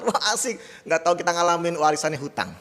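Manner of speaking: ordinary delivery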